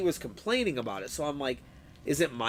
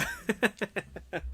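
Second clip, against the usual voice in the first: high, softer laugh; 5 semitones higher, 7 dB quieter than usual. Real, not acted. Streamer embarrassed by his raging, high laugh.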